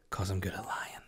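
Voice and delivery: whispered confession. Acted. Podcast host whispering his secret to listeners. Confiding punchline aside.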